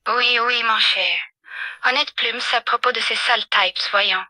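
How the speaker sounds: lightly grumbling in French; French-speaking character. Acted. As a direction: play the ostrich character in French.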